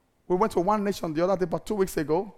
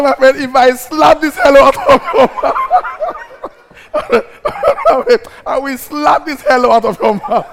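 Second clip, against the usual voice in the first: mock threat; 4 semitones higher, 9 dB louder than usual.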